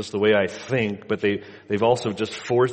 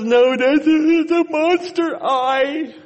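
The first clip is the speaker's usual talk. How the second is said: put-on high, loud, slow sing-song little boy; whiny toddler; 6 dB louder, slower than usual. Son telling father the smoke detector is a monster eye. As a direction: drag out monster eye like a small child.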